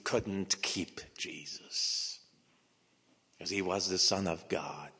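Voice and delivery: hushed whispered reveal. Acted. Preacher whispering the resurrection to the church. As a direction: lean in and whisper the truth.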